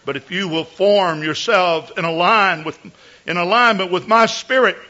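solemn prophecy in the voice of God; mock biblical prophet. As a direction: speak as the Lord, lofty and grave. Prophecy in God's voice.

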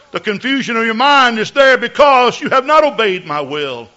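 solemn prophecy in the voice of God; mock biblical prophet. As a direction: speak as the Lord, lofty and grave. Prophecy in God's voice.

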